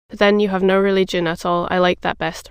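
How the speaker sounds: ordinary delivery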